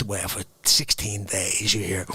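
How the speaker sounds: usual speaking voice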